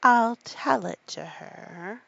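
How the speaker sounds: a slow, weighty promise; slow and heavy. Acted. Mock Turtle agrees slowly.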